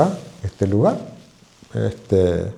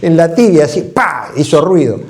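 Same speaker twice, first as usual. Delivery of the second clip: much higher and louder, reliving the smack; wincing excitement; 7 semitones higher, 5 dB louder than usual. Real, not acted. Doctor reliving the builder's shin blow, loud and wincing. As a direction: burst out as if you felt the blow yourself.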